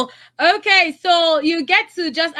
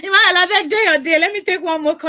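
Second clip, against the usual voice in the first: high, fast, laughing mock beg; playful cry of despair; mock pleading; 6 semitones higher, faster than usual. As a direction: pretend to beg through laughter. Host mock pleading through laughter.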